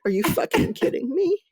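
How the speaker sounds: high, mock exasperated cry; incredulous rising whine of disbelief; Manuela's disbelief. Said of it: "Manuela's like".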